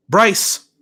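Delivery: high, loud call across the room; shouted name. A narrator's reading. Dad calling Bryce from his desk, loud shout. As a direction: snap his name to get his attention. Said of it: "my dad shouted from his desk".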